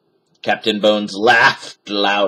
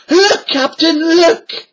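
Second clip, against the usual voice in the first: excited pirate; 6 dB louder, slower than usual. A narrator's reading.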